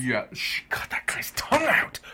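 breathless, high, unvoiced whisper; horrified whisper. Real, not acted. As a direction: whisper it in horror.

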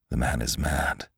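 low, hushed inner voice; inward thought. A narrator's reading. Lantry privately judges the scientist mad, low and hushed. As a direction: think it aloud, softly and low. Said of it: "thought Lantry".

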